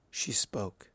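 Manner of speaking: usual speaking voice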